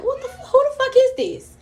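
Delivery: high-pitched, startled demand; retold panic. Dreamer seeing a long gray face, panicked. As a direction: act out panicked bewilderment, voice shooting up. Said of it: "I'm panicking".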